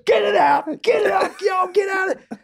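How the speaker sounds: very high-pitched mock shouting; frantic dad shouting. Dad ordering the mess out of the car, frantic shout. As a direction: yell orders in a hammed-up panic.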